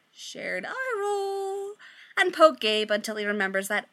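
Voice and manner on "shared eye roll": slow, high, sing-song sarcasm; sarcastic eye roll. Acted. Friends mocking Gabe in slow, high sarcasm. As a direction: stretch it out mockingly. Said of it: "the others are like".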